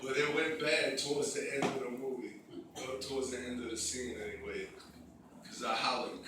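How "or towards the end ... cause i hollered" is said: usual speaking voice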